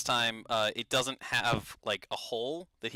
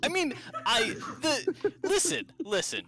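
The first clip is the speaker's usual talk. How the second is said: panicked ghost. Acted. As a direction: shriek at them to stop, mid-laugh. Ghost protests corpse chopping.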